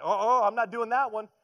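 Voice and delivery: high, loud mocking uh-uh refusal; type A refusal. Preacher mocks type A people, loud high refusal. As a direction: ham up an uptight planner saying no. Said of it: "All the type A people are like".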